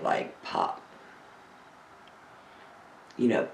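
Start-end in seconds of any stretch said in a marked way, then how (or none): 0.0-0.8 whispered pop. Shown as a demonstration. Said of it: "My mom laughs at me when I go".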